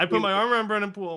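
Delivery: retelling his bold move, quick and high with disbelief; disbelief at own boldness. Retold or imitated. Telling the podcast host about his bold move, amazed and quick. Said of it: "This is not like me".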